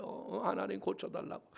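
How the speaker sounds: very soft breathy plea; hushed prayer. Retold or imitated. Pastor praying over the man, hushed. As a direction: barely voice the plea.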